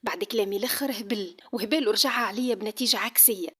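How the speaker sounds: plain reading voice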